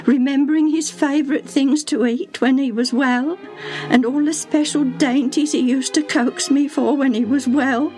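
high, distressed servant's lament; acted heartbroken pleading tone; grieving servant. A narrator's reading. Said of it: "Her voice broke down".

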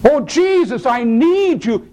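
high, stretched cry for help; desperate plea. Acted. Believer in trouble calls on Jesus, desperate plea. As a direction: plead with Jesus, dragging out the need.

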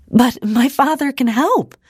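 high, urgent exclamation; exclaiming. A narrator's reading. Ida offers her father's help to the man, exclaiming. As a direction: exclaim it with the pitch lifted high. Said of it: "Ida exclaimed".

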